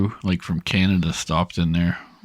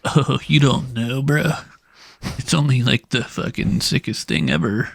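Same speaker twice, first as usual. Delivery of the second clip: mock bro; 5 semitones higher than usual. Mocking bro customers.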